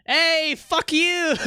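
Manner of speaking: high, slow, hammed-up New York "Hey, fuck you!"; New York attitude. Streamer teaching chat a New York greeting, hammed-up and high. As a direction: drawl it like a rude New Yorker in traffic. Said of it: "That's how you say it in New York".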